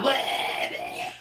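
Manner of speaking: high, breathy squawked "Blah, blah"; freaking-out bird. Retold or imitated. Host's parrot freaking out, high breathy squawked "Blah, blah". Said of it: "Just freaking out".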